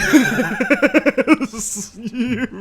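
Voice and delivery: loud high joyful laughter; elated laugh. Real, not acted. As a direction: laugh out in pure relief. Laughing after the win.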